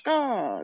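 high, falling crow caw; crow's call. Shown as a demonstration. Crows talking to each other, high calling caw. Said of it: "like a call and response".

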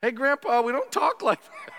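put-on very high little-kid squeak; grandkid voice. Grandkid corrects Grandpa, high-pitched child voice. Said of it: "kids to grandparents".

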